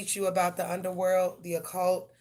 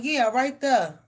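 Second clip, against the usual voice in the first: loud coaxing; 5 semitones higher, 8 dB louder, slower than usual. Retold or imitated. Directing cat down from tree.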